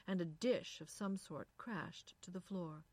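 usual speaking voice

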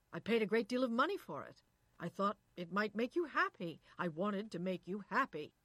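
heated reproach; reproachful. A narrator's reading. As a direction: protest it emphatically, hurt and annoyed. Husband in acted quarrel.

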